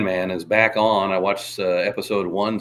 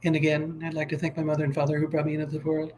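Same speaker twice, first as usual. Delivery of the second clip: light, higher, quick; 4 semitones higher, 4 dB quieter, faster than usual. Retold or imitated. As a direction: thank the parents like a soft-voiced pop star.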